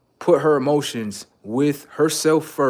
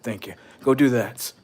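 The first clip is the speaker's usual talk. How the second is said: ordinary talk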